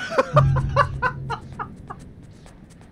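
helpless high laugh after a fright; high shocked laugh. Real, not acted. Player laughing after being knocked off, high laugh. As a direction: laugh it off in disbelief.

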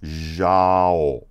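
zhao drawn out from a long "zh" buzz; stretched pronunciation. Shown as a demonstration. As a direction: stretch zhao slowly, falling at the end. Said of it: "So you gotta go zhao".

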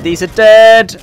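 loud urgent callout; combat callout. Real, not acted. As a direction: shout it across the fight. Repeated callout in a fight.

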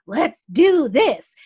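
loud, slow, fired-up rally cry; like Mars ready to charge in; drawn-out loud hype call; pumped up. Retold or imitated. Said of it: "he's pumped, he's powered".

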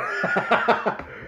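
breathy rattling chuckle; quick cackle before the plunge; laugh. Real, not acted. Reviewer about to eat hot jerky, laughing.

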